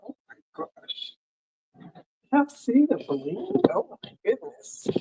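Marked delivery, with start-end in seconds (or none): 0.1-1.1 breathy hushed gasp of admiration; a soft amazed whisper; awed whisper. Real, not acted. Admiring the bling on shirt. 3.7-4.9 breathy hushed gasp of admiration; a soft amazed whisper; awed whisper. Real, not acted. Admiring the bling on shirt.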